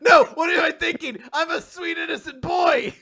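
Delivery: high, hammed-up shout; panicked self-scolding. Recapper acts the boy denying his dirty thoughts, panicked shout. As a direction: shout yourself down in mock panic.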